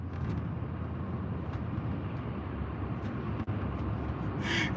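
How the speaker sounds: real breathless laugh with no voice; silent wheezing laugh. Real, not acted. Silent laughing fit after the quote.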